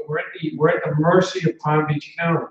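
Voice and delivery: normal talking voice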